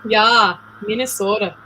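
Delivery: playful mock Minnesota accent. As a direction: lightly put on a Minnesotan accent. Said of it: "Do you have the Minnesota accent?".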